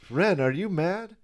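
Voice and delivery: high-pitched cartoon question; imitated cartoon sidekick. Host as the friend questioning Ren, high imitation. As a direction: ask it in a high put-on voice. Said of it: "Oh, yeah, he goes".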